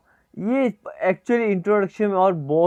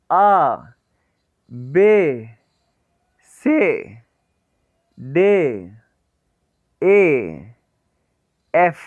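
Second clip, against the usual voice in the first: teacher calling letters one by one; alphabet recitation; 2 semitones lower, much slower than usual. Shown as a demonstration. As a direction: recite the alphabet for students to repeat. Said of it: "मेरे साथ साथ इसको pronounce करना".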